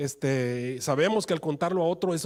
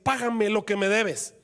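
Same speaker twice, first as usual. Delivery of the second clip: harsh demand; 6 semitones higher, 4 dB louder than usual. Acted. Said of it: "le ahogaba y le empezó a decir".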